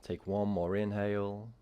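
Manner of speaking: calm, steady yoga teacher voice; clear, even instructor cues; yoga class cueing. Acted. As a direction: guide the class into the pose. Teacher leads breath work.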